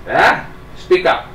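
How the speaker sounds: put-on loud, high old man. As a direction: call out like a deaf old man. Old man hard of hearing.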